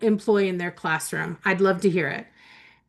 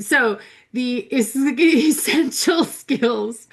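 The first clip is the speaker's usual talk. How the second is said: laughing through words; 3 semitones higher, 3 dB louder, much slower than usual. Real, not acted. Laughing while restarting.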